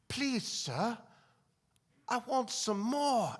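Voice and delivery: earnest orphan begging, last words drawn out; pleading orphan. Acted. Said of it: "he stirs up the courage".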